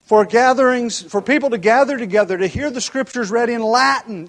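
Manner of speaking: preaching at full force. Acted. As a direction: lift the voice and hammer it home.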